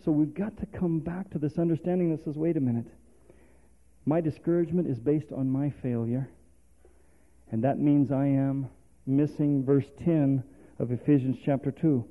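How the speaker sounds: normal talking voice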